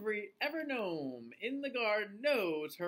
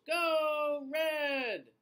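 crowd cheering; 10 semitones higher, 5 dB louder than usual. Acted. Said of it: "The crowd cheers".

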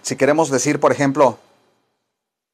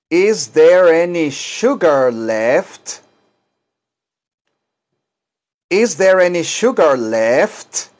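slow repeat-after-me drill; slower than usual. Shown as a demonstration. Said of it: "repitan ustedes".

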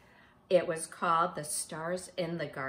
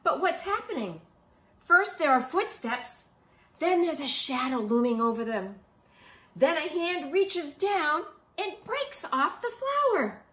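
building suspense, higher and louder; suspenseful narration; 8 semitones higher, 9 dB louder than usual. A narrator's reading. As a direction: lean in as the shadow looms. Suspense in story.

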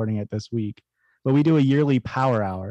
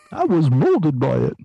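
slow, higher theatrical villain voice; Bane impression; 4 semitones higher, 4 dB louder, slower than usual. Carries on despite being told to stop, slow Bane impression. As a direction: stretch it out like a grandiose masked villain. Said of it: "Can you do a Bane?".

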